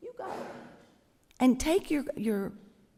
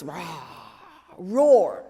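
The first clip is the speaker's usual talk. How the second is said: mock roar; 4 semitones lower, 7 dB louder than usual. Shown as a demonstration. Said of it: "like the voice".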